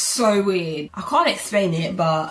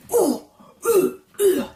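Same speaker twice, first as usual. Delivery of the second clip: hammed-up Serena Williams grunts; tennis grunts; 10 semitones higher, slower than usual. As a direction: grunt like a tennis star hitting a serve. Swatting fly like Serena Williams.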